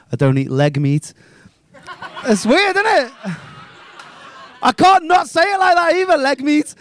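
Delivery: ordinary talk, nothing marked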